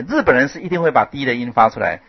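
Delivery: normal talking voice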